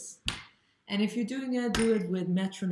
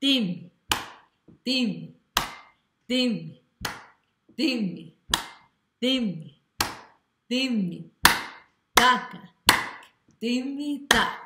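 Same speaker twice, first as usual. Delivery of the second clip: each "Dim" punched louder and higher on the beat; accent on di; 5 semitones higher, 5 dB louder, much slower than usual. Shown as a demonstration. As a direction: stress every "Dim" in time. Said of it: "emphasis on the third, on D".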